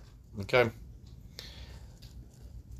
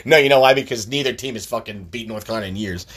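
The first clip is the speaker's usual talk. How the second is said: ordinary talk, nothing marked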